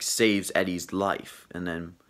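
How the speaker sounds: normal talking voice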